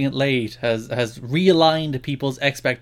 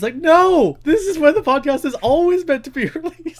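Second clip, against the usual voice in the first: mock outrage; 14 semitones higher, 8 dB louder than usual.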